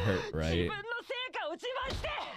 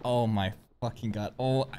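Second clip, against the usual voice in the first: normal talking voice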